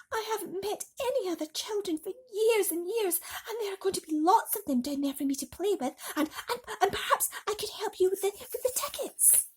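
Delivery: hurry, hopeful and stumbling; pleading boy. Acted. James pleads with his aunt.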